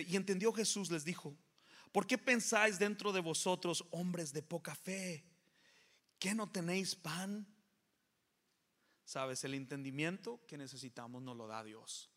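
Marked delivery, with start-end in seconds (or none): 0.0-7.5 loud, high preaching read; scripture proclaimed. Real, not acted. Preacher proclaiming Matthew to the church, loud and high. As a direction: proclaim the verse with full force. Reading scripture at full force.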